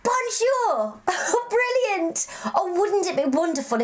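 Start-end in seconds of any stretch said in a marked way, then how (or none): 0.0-1.0 "Bonjour" higher, bon-zhoor; a higher, cheery "Bonjour"; French pronunciation. Shown as a demonstration. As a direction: model "Bonjour" high for kids to answer. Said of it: "Now you".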